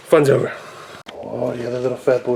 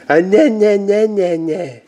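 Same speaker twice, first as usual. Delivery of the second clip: mock a fussy baby to get giggles; playful high "nah-nah" imitation; mimicking baby fussing; 5 semitones higher, slower than usual. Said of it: "your brother and the nah, nah, nah".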